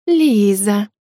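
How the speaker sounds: syrupy sweet calling of the name; sugary singsong. Acted. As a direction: coo the name falsely sweet. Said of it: "пропела елейным голосом блондинка". (блондинка елейно пропевает имя девочки)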